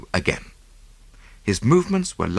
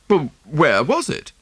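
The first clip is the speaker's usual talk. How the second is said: demanding; 8 semitones higher, 3 dB louder, slower than usual. A narrator's reading. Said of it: "demanded Oppelson".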